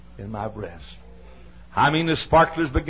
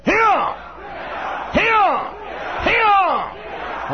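high, loud sharp bark; sharp shout; 3 dB louder, much slower than usual. Shown as a demonstration. Preacher shouting at an unruly toddler, sharp bark. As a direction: snap the word out to freeze the child. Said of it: "Say it".

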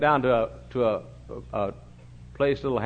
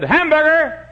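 high, loud, blunt call; loud order; 13 semitones higher, 7 dB louder, slower than usual. Retold or imitated. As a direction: bark the single word order. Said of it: "ordered a hamburger and said".

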